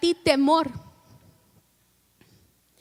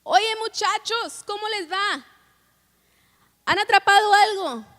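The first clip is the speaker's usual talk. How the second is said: high-pitched shout to men out on the water; calling out; 6 semitones higher, 3 dB louder than usual. Acted. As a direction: hail them from the shore, voice raised high. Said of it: "Y les grita".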